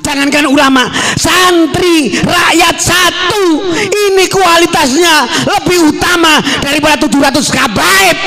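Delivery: high, forceful rally oratory; impassioned sermon pitched high; fiery preaching. Real, not acted. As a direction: ring it out to the crowd at full force. Preaches at full force to crowd.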